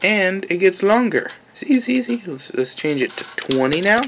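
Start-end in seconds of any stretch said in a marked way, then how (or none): 0.0-2.2 high, eager look-at-this; excited. Real, not acted. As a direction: squeal with delight at the change. Showing the object grow.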